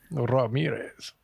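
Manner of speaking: Ramirez slow and soft with a rolled r; a quiet Scottish roll on Ramirez. Retold or imitated. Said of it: "but he rolls it".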